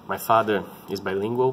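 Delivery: ordinary delivery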